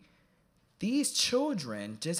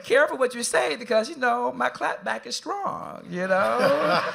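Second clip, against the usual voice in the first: much higher, louder, slowed mock threat; sassy warning; 9 semitones higher, 6 dB louder than usual. Speaker retelling his playful warning to a fourteen-year-old, sassy. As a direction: play the diva warning a kid.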